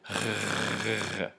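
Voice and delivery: throaty voiced R buzz; gargling voiced rasp at the back; voiced uvular fricative. Shown as a demonstration. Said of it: "So we have the voiced uvular fricative".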